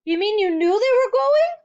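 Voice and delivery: very high, loud accusing cry; hurt surprise; cried out. A narrator's reading. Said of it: "Matilda cried".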